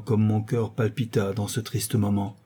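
ordinary delivery, nothing marked

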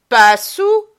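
high, loud scornful question; scornful mock question; mocking echo. A narrator's reading. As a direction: throw his words back at him. Girl mocks his denial.

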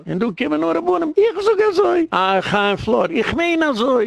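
very high-pitched mock pronouncement; mocking rabbis. Mimicking rabbis giving their opinion.

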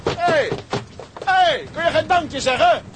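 very high, loud shouts after her; calling out. Acted. Man shouts after the girl who leaves without thanks, loud call. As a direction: yell after her as she runs off.

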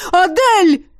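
high, loud yell of a name. Acted. Karakol yelling across the noisy hall, loud high yell. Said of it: "Караколь вопил".

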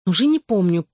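ordinary delivery, nothing marked

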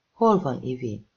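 plain reading voice

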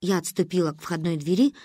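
ordinary delivery

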